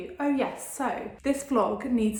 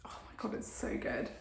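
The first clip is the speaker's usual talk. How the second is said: breathy whisper of pleasure; whispered delight; 12 dB quieter than usual. Real, not acted. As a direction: whisper it, savouring the scent. Smelling perfume, whispered delight.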